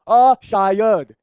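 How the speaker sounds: very slow, drawn-out unsure answer; long doubtful "umm, maybe"; hesitant student. Acted. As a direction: stretch it out like a pupil who is guessing. Acts unsure student answering question.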